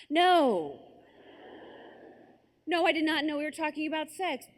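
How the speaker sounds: high, loud, emphatic disbelief; acted shock. Girl learning what friends meant, acted shock. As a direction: blurt out a scandalized denial.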